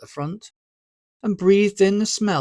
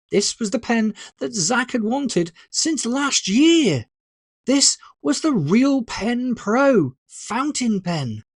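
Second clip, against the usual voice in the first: excited; 7 semitones higher than usual. A narrator's reading. Narrator gushing over the pen.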